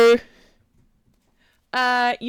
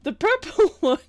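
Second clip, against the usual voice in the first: laughing; 9 semitones higher, 5 dB louder than usual. Real, not acted. Game master retrying line, still laughing.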